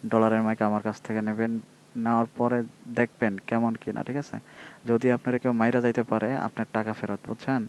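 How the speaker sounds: ordinary talk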